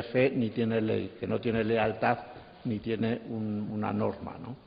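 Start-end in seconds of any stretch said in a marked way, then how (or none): none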